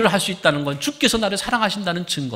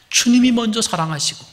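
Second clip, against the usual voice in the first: usual speaking voice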